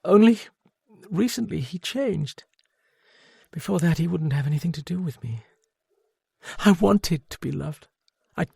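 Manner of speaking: high, small and broken; lightly voiced broken woman. A narrator's reading. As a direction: let the self-pity crack through. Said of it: "She talked in a small, broken voice".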